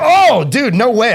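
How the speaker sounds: hammed-up jaw-drop at the price; mock shocked disbelief. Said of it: "And they go".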